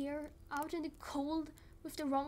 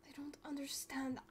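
hushed, breathless whisper; 13 dB quieter than usual. Acted. Searching woods for missing Belle, frantic whisper. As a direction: whisper so no one hears.

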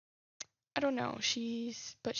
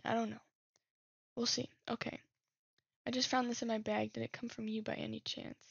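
ordinary talk, nothing marked

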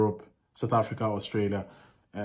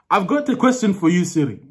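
higher, louder Nigerian accent; raised Nigerian-accented announcement; 6 semitones higher, 6 dB louder than usual. Shown as a demonstration. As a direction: address the assistant in a Nigerian accent. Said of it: "Nigerians, uh, when they ask about eggs".

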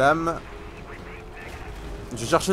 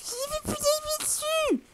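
squeaky mock voice; 6 dB quieter than usual. Repeat in mocking squeaky voice.

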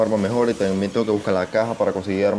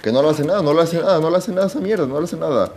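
high, loud exasperated repeat; rising panic that nothing works; frustrated disbelief; 7 semitones higher, 3 dB louder than usual. Real, not acted. Grenade fails against monster, frustrated.

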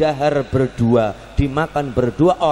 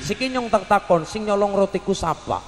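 ordinary delivery

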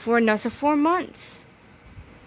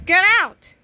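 loud, higher urgent shout; 8 semitones higher, 7 dB louder than usual. Acted. Betty warns the lunch lady over the radio, loud and urgent. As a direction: yell the warning.

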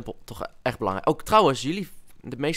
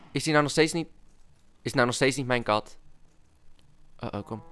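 ordinary delivery

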